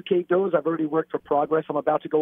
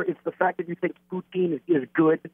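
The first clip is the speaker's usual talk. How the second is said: ordinary delivery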